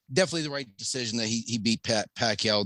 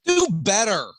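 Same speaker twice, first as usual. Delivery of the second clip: loud, higher punchy "Do better"; catchphrase on request; 7 semitones higher, 5 dB louder than usual. Acted. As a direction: snap the slogan out loud. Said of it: "[name], can I get one?".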